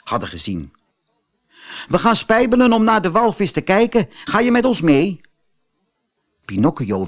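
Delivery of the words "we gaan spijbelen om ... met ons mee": put-on high, cheeky boy voice; a naughty boy daring a friend to skip school; teasing schoolboy. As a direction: schoolboys tempting Pinocchio to play truant. Schoolboys tempt Pinocchio.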